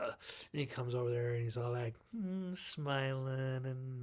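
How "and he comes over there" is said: normal talking voice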